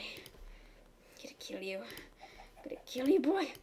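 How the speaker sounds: slow, soft whispered playful menace; whispered mock threat. Threatening a naughty game animal, slow whispered mock menace. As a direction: hiss the threat slowly and softly.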